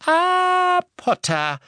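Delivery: put-on high, crisp elderly lady voice; brisk old lady. A narrator's reading. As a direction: clip it like a brisk grandmother. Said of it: "sagte sie forsch".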